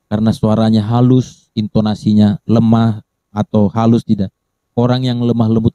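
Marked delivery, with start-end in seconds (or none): none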